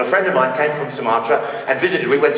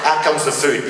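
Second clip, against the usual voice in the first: usual speaking voice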